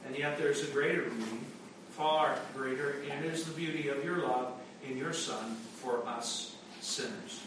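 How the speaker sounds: ordinary talk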